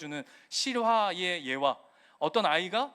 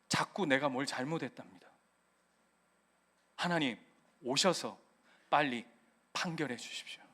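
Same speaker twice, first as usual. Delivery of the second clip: anguished prayer; 8 semitones lower, 6 dB quieter than usual. Retold or imitated. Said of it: "어떤 기도를 하는지".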